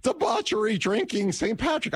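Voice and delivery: high, flustered word salad; incoherent blabber. Retold or imitated. Host filling dead air on Saint Patrick's Day, high flustered blabber. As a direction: grab at any words to fill the air. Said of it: "blabber incoherently".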